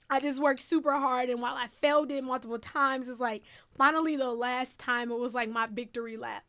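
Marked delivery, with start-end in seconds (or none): none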